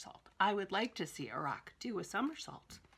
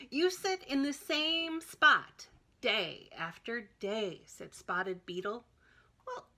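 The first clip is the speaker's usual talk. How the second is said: put-on reading to children; 3 semitones higher, 5 dB louder than usual. Reading picture book aloud.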